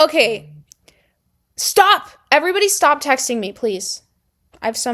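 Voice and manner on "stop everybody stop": higher, louder sudden "Stop."; sharp real outburst. Real, not acted. Snapping at the texts flooding her phone, loud. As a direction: cut off the buzzing phone. Phone keeps buzzing mid-video.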